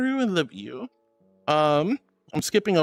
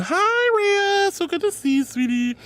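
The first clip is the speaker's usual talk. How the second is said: excited hi; 12 semitones higher, slower than usual. Real, not acted. Streamer greeting a friend in chat, excited squeal.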